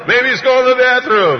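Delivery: very high, loud taunt; mocking high yell; shouted sarcasm. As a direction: holler the jibe at the top of the voice. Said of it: "sarcasm and cynicism in this".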